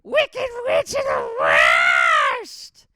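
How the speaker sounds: shrieking loud witch voice; Wicked Witch shout. Acted. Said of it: "when you're a Wicked Witch".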